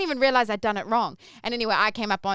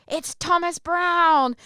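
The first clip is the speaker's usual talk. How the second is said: retold yell; 9 semitones higher, 4 dB louder, much slower than usual. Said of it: "yelled up to me".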